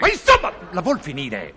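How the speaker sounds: exasperated outburst. Acted. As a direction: burst out, fed up. Fed up with the insults.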